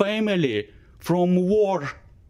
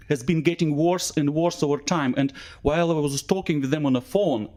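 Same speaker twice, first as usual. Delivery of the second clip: ordinary talk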